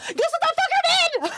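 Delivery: very high, loud squealed outburst; high-pitched exasperated yell. Retold or imitated. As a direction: squeal it in comic outrage. Exasperated retelling of the road mishap.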